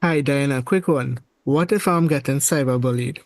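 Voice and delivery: ordinary talk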